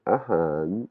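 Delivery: "ahan" dipping, then rising at the end; blue contour tone. Shown as a demonstration. Teacher repeats the blue word, dipping-rising "ahan". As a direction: bend "han" down and swing it up. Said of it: "on a du bleu".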